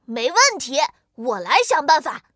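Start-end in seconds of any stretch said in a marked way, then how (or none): none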